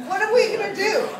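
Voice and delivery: normal talking voice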